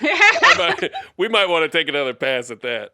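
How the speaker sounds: laughing through the words; giggling while trying to sing. Real, not acted.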